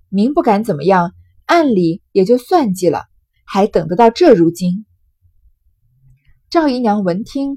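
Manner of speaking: loud, deliberate classical novel reading; story narration. A narrator's reading. Storyteller reading the scheming women's dialogue to listeners, projected narration. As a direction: read it out loudly with measured phrasing.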